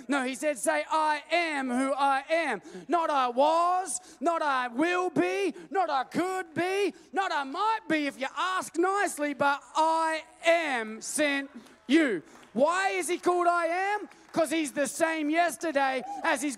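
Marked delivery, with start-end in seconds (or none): 0.6-2.6 loud, slow, ringing declaration; proclaiming. Real, not acted. Preacher declares God's name to the congregation, loud and slow. 2.9-12.2 loud, building sermon cadence; preaching. Real, not acted. Preacher builds up the congregation, loud and fervent.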